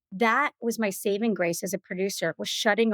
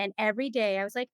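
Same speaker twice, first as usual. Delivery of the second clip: usual speaking voice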